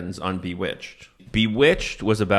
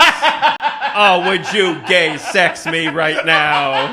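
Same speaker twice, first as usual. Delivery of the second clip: higher, louder, slow hammed-up proposition; mock British come-on; 6 semitones higher, 7 dB louder, much slower than usual. Laughing mock come-on. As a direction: podcaster mocking British slang as a pickup line, slow and laughing.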